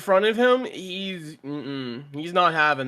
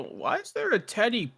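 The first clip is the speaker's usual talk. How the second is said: lightly voiced baffled guard, pitched up; softer, higher voice of a confused guard; puzzled guard; 7 semitones higher, 4 dB quieter than usual. Guard finding a teddy on the stairs, puzzled.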